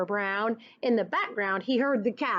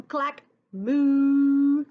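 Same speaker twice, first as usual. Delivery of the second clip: loud drawn-out moo; cow moo; 3 semitones higher, 7 dB louder than usual. Acted. Teacher voicing the cows with a long loud moo.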